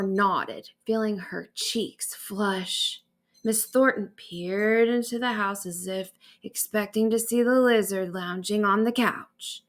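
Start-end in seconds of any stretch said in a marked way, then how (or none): none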